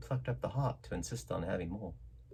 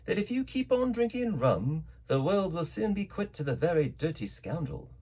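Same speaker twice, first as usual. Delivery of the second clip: calm doctor; 6 semitones higher than usual. A narrator's reading. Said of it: "rather high ... perfectly calm and steady".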